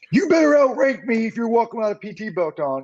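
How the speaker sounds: high-pitched drill sergeant's challenge. Retold or imitated. Sergeant challenging a soldier without a belt, drill sergeant. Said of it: "fucking drill sergeant".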